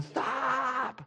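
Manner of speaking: put-on high, strained call after a runaway child; mock desperate yell. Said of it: "she's going".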